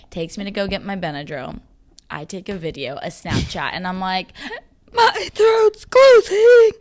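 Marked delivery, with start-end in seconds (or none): 4.9-6.7 high, loud, slow, tearful wail; crying panic. Retold or imitated. Imitating her Snapchat video during an allergic reaction, tearful wail. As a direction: wail it like your throat is really closing. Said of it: "dead ass how I sound".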